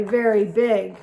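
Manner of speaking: ordinary delivery, nothing marked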